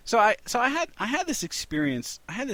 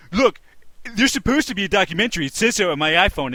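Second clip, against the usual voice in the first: angry customer; 5 semitones higher, 10 dB louder, faster than usual. Retold or imitated. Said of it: "The one, one dude's like".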